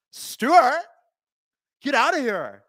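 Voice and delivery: overdone Californians-skit "get outta here"; Californians skit accent. Pretend. Imitating the skit's catchphrase in an exaggerated SoCal accent. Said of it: "not be able to do the L A accent".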